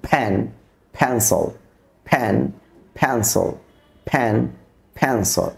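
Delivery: ফ্যান and ফ্যান্সল begun with a puff of air, as p-h; isolated, falling ফ্যান, ফ্যান্সল with an airy p release; aspirated p. Shown as a demonstration. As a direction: model ফ্যান, ফ্যান্সল word by word, first p aspirated. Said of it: "শব্দ যদি শুরু হয় ফ".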